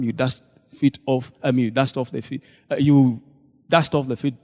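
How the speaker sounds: ordinary delivery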